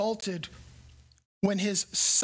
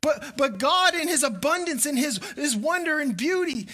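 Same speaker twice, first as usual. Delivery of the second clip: raised, earnest preacher's voice; preaching; 5 semitones higher than usual. Acted. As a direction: lift the congregation with the promise.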